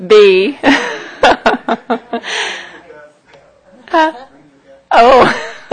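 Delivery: breaking into real laughter; can't stop giggling; laughing. Real, not acted. Bursts out laughing mid-auction.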